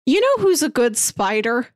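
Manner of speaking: ordinary talk, nothing marked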